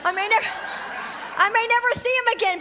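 urgent, laughing eagerness to seize the moment; excited. Retold or imitated. Preacher reliving her eager rush to the gym, excited. Inner thoughts, eager to witness.

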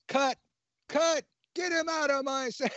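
high-pitched mock director's yell; put-on, high-pitched holler across a film shoot; imitated yelling. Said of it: "then I hear somebody yelling".

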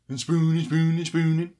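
high, loud Muppet chef gibberish; mock Swedish Chef, burbling along. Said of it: "feels all Swedish Chef".

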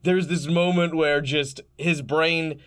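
dramatic scene-setting narration, slowing for effect; game master narration. Acted. Game master narrating to the players, in a dramatic storytelling voice.

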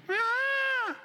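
put-on high baby wail; imitated baby cry. Preacher imitates his baby daughter crying in the house, high wail. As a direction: cry out like an infant. Y de repente escucho.

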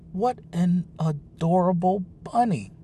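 high, delighted coo at a cute pet; cooing over a bunny. A narrator's reading. Woman coos over the rabbit.

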